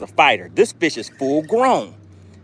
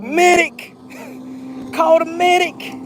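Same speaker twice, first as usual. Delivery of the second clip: high, slow frantic call for a medic; mock panic; slower than usual. Reading a chat joke, mock-yelling for a medic. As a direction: cry out for help like a panicked bystander. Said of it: "Cayman says".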